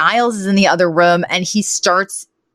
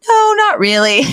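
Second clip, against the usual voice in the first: high-pitched, acted nervous denial; mock nerves, voice jumping way up; 12 semitones higher, 3 dB louder than usual. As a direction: squeak out an unconvincing denial. Acted nervous man denying nerves.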